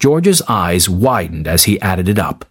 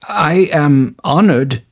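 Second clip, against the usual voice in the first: hesitant stammer; 5 semitones higher, slower than usual. Acted. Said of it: "he stammered".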